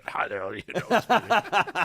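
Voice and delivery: lightly voiced indignant tough guy; gruff mock Jack. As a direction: toss it back like a caught troublemaker. Jack's gruff retort retold.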